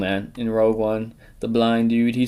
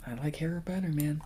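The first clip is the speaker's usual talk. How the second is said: whispering; 4 semitones higher, 11 dB quieter than usual. Real, not acted. Whispered confession.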